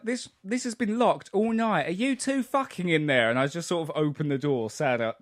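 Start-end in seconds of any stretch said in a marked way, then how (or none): 0.0-3.3 mock-indignant, pitched high; indignant retelling. Someone demanding who is inside the locked toilet, mock indignant. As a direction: act out someone fed up outside a locked door. Retold exasperated person at locked door.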